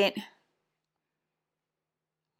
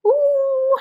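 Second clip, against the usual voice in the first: high teasing ooh, lightly mimicked; a drawn-out high ooh of mock awe; impressed ooh; 4 dB louder than usual. Mocking her boyfriend's reaction to her stern phone call, a high ooh. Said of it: "you were like".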